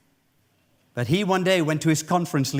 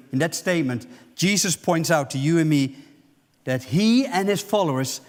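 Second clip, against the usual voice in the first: normal talking voice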